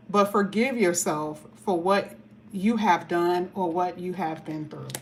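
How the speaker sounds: ordinary talk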